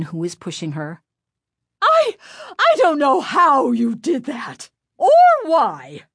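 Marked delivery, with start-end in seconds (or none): 1.8-6.0 high, loud, faltering fury; angry shout. A narrator's reading. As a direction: shout furiously at the pupil, tripping at the start. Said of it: "Missus Berman shouted angrily".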